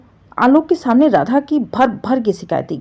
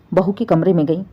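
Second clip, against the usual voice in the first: ordinary delivery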